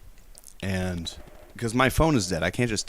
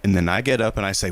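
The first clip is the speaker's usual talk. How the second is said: usual speaking voice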